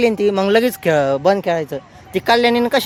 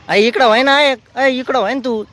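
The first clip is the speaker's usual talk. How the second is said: high call across the yard; 6 semitones higher than usual. Real, not acted. Calling the little girl to come over, high call. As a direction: call her over, higher.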